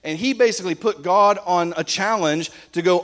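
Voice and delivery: normal talking voice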